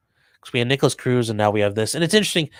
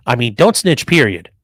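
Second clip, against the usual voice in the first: ordinary delivery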